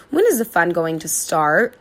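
usual speaking voice

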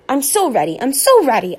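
high, excited repeated words; excited puppy yelps it out; eager barking. A narrator's reading. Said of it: "I bark, leaping on her legs".

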